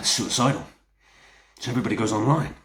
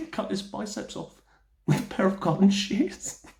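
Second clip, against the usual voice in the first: amused; 8 semitones higher than usual. Acted. Laughs telling a grisly anecdote.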